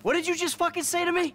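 put-on rear up at the kid, furious; outraged shout. Father rounds on son.

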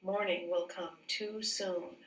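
usual speaking voice

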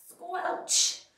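wet squelch sound effect; squelch noise. Acted. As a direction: make the squish noise.

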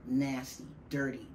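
usual speaking voice

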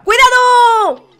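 high, loud and slow alarm call; drawn-out warning shout. Real, not acted. Commentator warns a player as the tower wobbles, long loud shout. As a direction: stretch the warning out at full voice.

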